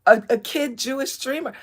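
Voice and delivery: loud, high mock plea; imitated young streamer. Streamer mocking a young man pleading he's just a kid, whiny. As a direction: mimic a put-upon kid protesting. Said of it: "the most passive-aggressive".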